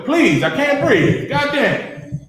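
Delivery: imitated desperate plea; retold pleading. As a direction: beg for air, voicing his words. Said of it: "He pleading with him".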